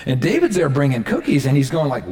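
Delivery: ordinary delivery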